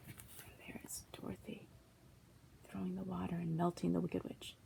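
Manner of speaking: soft unvoiced aside; whisper. Real, not acted. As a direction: breathe it as an aside.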